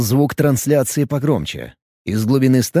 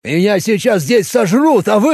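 indignant complaint; 7 semitones higher, 4 dB louder than usual. Acted. Bob outraged, cut off.

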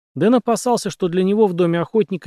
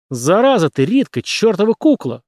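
raised, loud scolding squeal; high, exasperated outburst; angry screech; 6 semitones higher, 5 dB louder than usual. A narrator's reading. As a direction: screech the insult. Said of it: "взвизгнул режиссёр".

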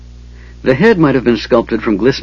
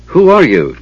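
raised-pitch challenge; called out; 7 semitones higher, 4 dB louder than usual. A narrator's reading. Tanis calling to the unseen voice, challenging. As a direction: call into the dark, mildly raised. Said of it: "Tanis called".

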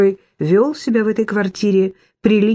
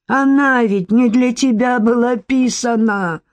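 put-on town drunk; 5 semitones higher than usual. A narrator's reading. Drunk scolding the dog.

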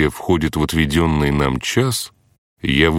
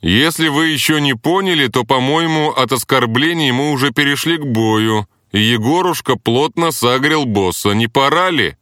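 raised voice; 9 semitones higher than usual. Acted. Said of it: "повысил голос я".

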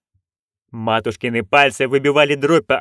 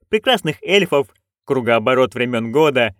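ordinary delivery, nothing marked

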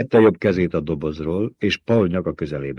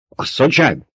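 sharp, raised voice, a touch higher; indignant snap; 7 semitones higher, 3 dB louder than usual. A narrator's reading. Reverend Mother snapping back at Paul's "asszonyság", sharp and raised. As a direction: bristle at being called madam. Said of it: "csattant fel a tisztelendő anya".

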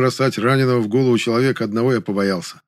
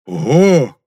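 loud, raised delighted exclamation; happy; 4 semitones higher, 8 dB louder than usual. A narrator's reading. Гришка sees extra vodka, happily exclaiming. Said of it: "сказал Гришка счастливым голосом".

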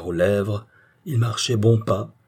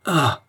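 cri bref; 7 semitones higher, 4 dB louder than usual. A narrator's reading. Cri de surprise sous le coup.

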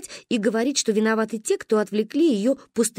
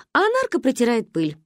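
usual speaking voice

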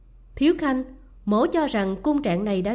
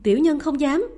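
louder, higher frantic begging; desperate repeated plea; 4 semitones higher, 6 dB louder than usual. A narrator's reading. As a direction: beg again, more desperate. Kneeling servants plead in fear.

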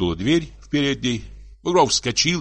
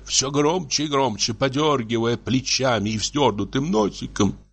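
usual speaking voice

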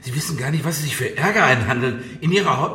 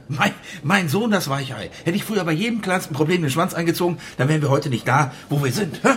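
normal talking voice

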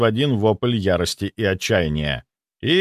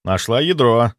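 ordinary delivery, nothing marked

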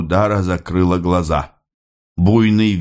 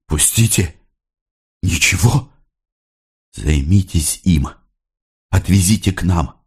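Sofia's urgent orders. A narrator's reading. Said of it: "быстро говорила Софья".

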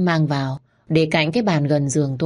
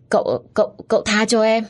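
choked, higher-pitched begging; feeble gasped plea; 6 semitones higher than usual. A narrator's reading. Choked narrator begs to be released, gasping feebly. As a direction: plead haltingly as if short of air. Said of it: "Tôi thều thào".

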